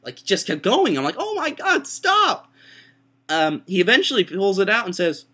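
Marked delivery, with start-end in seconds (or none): 1.2-2.5 very high, loud mock scream; hammed-up squealing outburst; panicked protest. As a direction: shriek for it to stop. Acts out protest during throat scraping.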